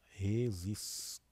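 "resistiu" drawn out slowly, R at the start; initial strong R. Shown as a demonstration. Teacher showing pupils the R at word start, sounded out slowly. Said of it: "É o R sempre no começo".

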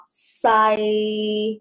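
西 held level at mid pitch, Cantonese third tone. Shown as a demonstration. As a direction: keep 西 flat in the middle. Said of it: "它也是平的哦".

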